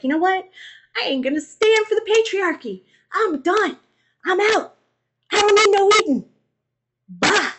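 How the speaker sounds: sassy Lilith. Retold or imitated. Lilith walking out on Adam, high and sassy. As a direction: toss it off like a diva walking out. Lilith voiced leaving Eden.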